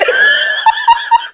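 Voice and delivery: very high crying laugh; tearful laugh. Real, not acted. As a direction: squeal with laughter through real tears. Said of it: "I'm about to start crying".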